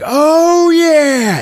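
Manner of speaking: loud, high celebratory cheer; hammed-up victory shout; mock triumph. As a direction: whoop it like you just won. Brian celebrates kill, retold.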